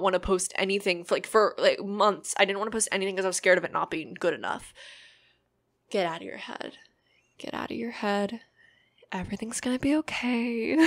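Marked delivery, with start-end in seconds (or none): 6.2-10.8 ASMR whisper. Shown as a demonstration. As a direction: whisper it soothingly. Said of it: "I'm bringing out the A S M R voice".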